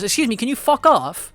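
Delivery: sweetly polite, then tell him to get lost; Buck telling off a reporter. Retold or imitated. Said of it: "literally slides in and goes".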